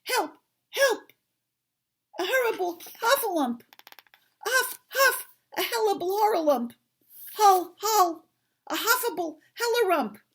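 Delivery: high, loud, breathless squeals; frantic little character yelling on the run; panicked Piglet crying out. Acted. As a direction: tumble over the words in utter panic. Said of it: "still crying out".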